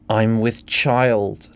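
slow, weighty announcement of the news; slow declaration. Acted. Helen announcing her pregnancy to the family, slow and deliberate. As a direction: let each word land slowly. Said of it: "declared Helen".

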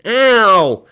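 loud, high drawn-out howl; yell of pain. Acted. Billy discovers his eyebrows burned, howling. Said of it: "yelled Billy".

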